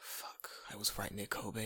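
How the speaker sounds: slow whispered swearing; hushed inner panic. Retold or imitated. Teacher hearing student say Kobe, hushed dread. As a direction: mutter it under the breath.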